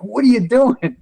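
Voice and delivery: high-pitched, alarmed retold outburst; losing it. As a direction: mimic a doctor losing it. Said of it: "he lost it".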